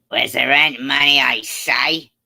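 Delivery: villain voice. Acted. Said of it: "act out the whole live stream in different voices".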